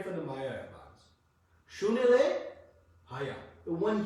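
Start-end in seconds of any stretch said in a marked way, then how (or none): 1.8-2.4 Sunile sung out high and loud with a rise; stress on Sunile. Shown as a demonstration. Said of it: "This Mayavadi Bhashya".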